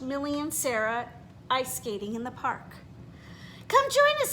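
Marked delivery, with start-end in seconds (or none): 3.7-4.3 much higher, louder friendly call; Millie's call. Acted. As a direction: call out to her, a girl's voice. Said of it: "said Millie".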